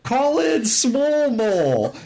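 high, slow cartoon rodent voice; Simon from the Chipmunks. Imitating a player's mole queen summoning her champion, high chipmunk voice. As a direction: stretch it out in a squeaky falsetto. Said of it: "like Simon from the Chipmunks".

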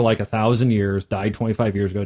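ordinary delivery

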